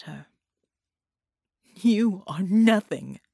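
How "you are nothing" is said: slow, loud, contemptuous cry. A narrator's reading. Villainess to her captive, a loud slow scornful cry. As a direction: crush him with the word nothing. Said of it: "she cried".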